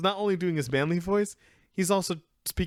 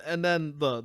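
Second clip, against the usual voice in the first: usual speaking voice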